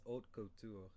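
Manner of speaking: "haute couture" said French-style, "oat koo-TOOR"; French pronunciation. Shown as a demonstration. Teacher correcting a student's reading, French pronunciation. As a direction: say "haute couture" slowly with a silent h. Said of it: "I'm telling you the French way".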